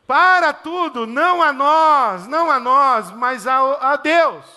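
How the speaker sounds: loud, high declamation with drawn-out words; psalmist's proclamation. Acted. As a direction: cry it out like a psalmist halting everything. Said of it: "Aí vem o salmista e diz assim". (proclamação de salmista, forte e solene)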